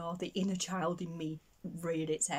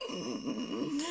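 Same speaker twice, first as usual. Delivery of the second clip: pained whimper; 6 semitones higher than usual. Retold or imitated. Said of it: "I was like".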